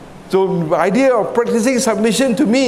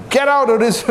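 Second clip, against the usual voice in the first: higher-pitched mock telling-off; retold scolding; 6 semitones higher than usual. Officer scolds young recruit at army camp, retold scolding. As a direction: order him out, imitating a cross officer. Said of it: "scolded him".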